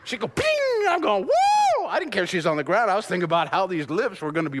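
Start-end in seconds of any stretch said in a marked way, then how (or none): 0.3-0.5 high ringing "ping" noise; imitated ping. Preacher imitating his sweetheart keeling over, comic ping. 1.2-1.3 long, high, loud "woo"; excited whoop. Acted. Preacher reliving his joy after a kiss, loud whoop.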